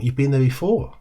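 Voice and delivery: "before" rising at the end, echoed back as a question; question inflection. Shown as a demonstration. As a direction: lift "before" upward to ask. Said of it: "There's the inflection".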